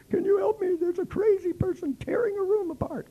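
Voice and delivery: high, frantic manager voice; imitating a flustered hotel manager; panicked manager. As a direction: beg for help in a high voice.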